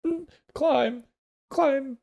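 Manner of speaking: high, loud, bewildered animal voice; confused camel voice. Acted. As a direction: squeak it out like a lost camel.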